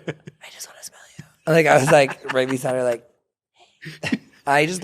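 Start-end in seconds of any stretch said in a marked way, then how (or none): 0.1-1.5 soft, creepy mock whisper; self-mocking hushed confession; whispered fan adoration.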